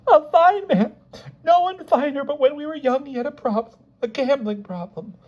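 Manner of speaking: very high voice, fading as it confesses; tearful outpouring. A narrator's reading. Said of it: "like her tears".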